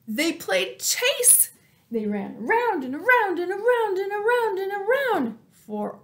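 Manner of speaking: excited. A narrator's reading. Excited telling of chase.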